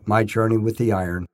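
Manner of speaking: plain reading voice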